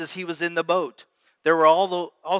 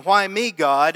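louder, imitated outcry to God; mock yelled complaint; 7 dB louder, slower than usual. Voicing the flesh crying out to God, mock yell. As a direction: raise it as a mock protest. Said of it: "is yelling, is crying, is whining".

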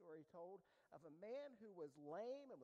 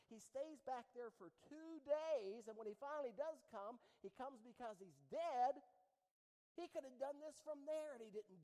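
louder, driving sermon delivery; full-force preaching; 4 semitones higher, 11 dB louder than usual. Acted. Pastor pressing the congregation on fickle reactions, loud preaching. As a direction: preach it to the back row.

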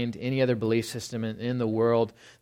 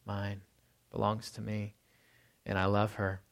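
tender claim; 3 semitones lower, 8 dB quieter than usual. Retold or imitated. Man claiming woman as his.

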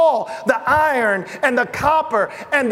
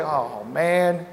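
moved sigh; 8 semitones lower, 6 dB quieter than usual. Retold or imitated. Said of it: "the way he says it".

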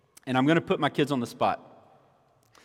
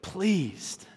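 louder, higher, climbing preacher's cry; full-force preaching; 8 semitones higher, 9 dB louder than usual. Acted. Pastor to congregation, impassioned preaching. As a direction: lift the room to a crescendo.

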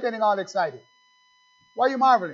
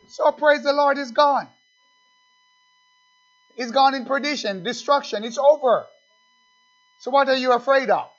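fiery preaching; 7 semitones higher than usual. Acted. Preacher tells the church the beast is gone, fiery. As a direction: preach it home with fire. Preaching rises to full force.

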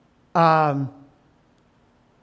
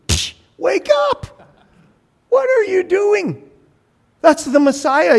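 high, exclaiming wake-up call; acted-out exasperated telling-off; mock scolding; 11 semitones higher, 4 dB louder than usual. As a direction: rebuke a fool in a high, raised voice. Acted scolding of Mister Pharisee.

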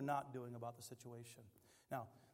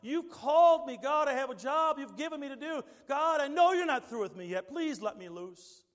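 pleading with God; 11 semitones higher, 11 dB louder than usual. Pretend. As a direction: plead for release. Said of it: "crying out to God and pleading".

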